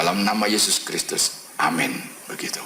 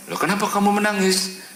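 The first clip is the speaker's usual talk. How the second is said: very high-pitched, incredulous question; retold astonished challenge; puzzled questioning. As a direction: ask in raised pitch, as if tears are uncalled for. Pastor questions the crying child.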